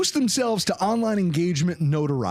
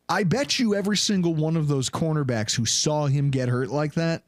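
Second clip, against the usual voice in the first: ordinary talk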